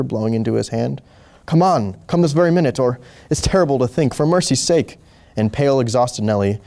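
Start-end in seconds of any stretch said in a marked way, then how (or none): 1.5-5.0 higher-pitched, pressing plea; urgent pleading. A narrator's reading. Wife begging sleepy doctor to come, urgent pleading. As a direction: beg him to hurry.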